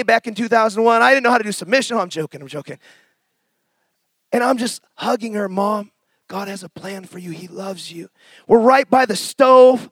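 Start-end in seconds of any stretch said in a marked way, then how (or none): 5.5-8.1 very soft, low, tender reassurance; gentle comfort. Retold or imitated. Son hugging his raging mother and reassuring her, very soft. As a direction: hush it close as if holding her.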